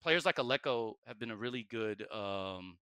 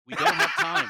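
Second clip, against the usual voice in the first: high, loud laughing cry of no time; laughing squeal; 10 dB louder than usual. Real, not acted. Jokes about running out of time.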